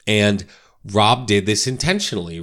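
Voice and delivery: ordinary talk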